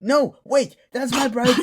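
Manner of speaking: loud, high cry of protest; alarmed shout. Acted. Woman shouting to stop the patient using her biscuit tin. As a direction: yell to stop them in time.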